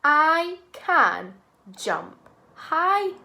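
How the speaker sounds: slow, clear teacher model; slow model sentence. Shown as a demonstration. Teacher models sentence slowly for class, clear. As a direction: say each word slowly and clearly. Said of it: "The sentence is".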